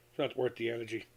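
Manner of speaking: quietly repeating to himself. Real, not acted. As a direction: let the repeat drop away quietly. Repeats the point more softly.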